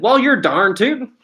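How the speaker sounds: hammed-up country accent, pitched high; put-on hillbilly twang, high and lively; playful country accent. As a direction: play up the Southern accent for a joke. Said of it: "small bit of an accent".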